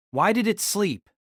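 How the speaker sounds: ordinary talk, nothing marked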